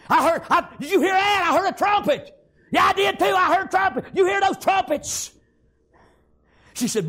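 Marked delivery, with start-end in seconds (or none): 0.1-5.3 high, loud, breathless excitement; excited shouting. Retold or imitated. Townsfolk shouting to each other, high and excited. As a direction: shout it like you just heard the trumpets.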